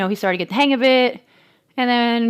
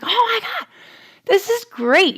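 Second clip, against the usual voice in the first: much higher, excited outburst; thrilled squeal; 13 semitones higher, 3 dB louder than usual. Retold or imitated. Mom watching son cruise around, high thrilled squeal. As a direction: squeal with delight at the kid's success. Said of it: "and I'm like".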